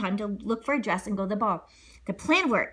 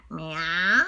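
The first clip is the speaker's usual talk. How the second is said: meow; 5 semitones lower than usual. Acted. Cat noise.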